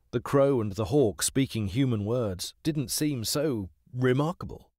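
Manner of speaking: ordinary delivery, nothing marked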